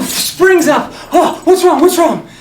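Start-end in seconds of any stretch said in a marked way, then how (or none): 1.1-2.3 high, loud alarmed question; startled mom. Retold or imitated. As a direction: jolt awake in alarm.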